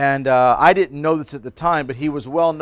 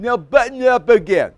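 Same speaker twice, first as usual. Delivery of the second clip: higher, louder insistent command, imitated; mimicking classmate; 9 semitones higher, 7 dB louder than usual. Classmate orders the pastor to unbutton his shirt. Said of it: "I'm gonna mimic him".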